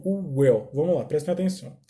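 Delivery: usual speaking voice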